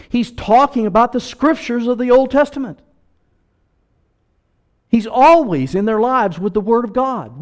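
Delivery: loud, high preacher's climax; full-force preaching. Acted.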